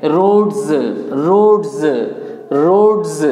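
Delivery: रोड्स as "rodz", falling; correct pronunciation. Shown as a demonstration. Teacher repeating for learners, correct pronunciation रोड्स as "rodz". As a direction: repeat रोड्स with voiced z at the end. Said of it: "रोड्स का सही pronunciation".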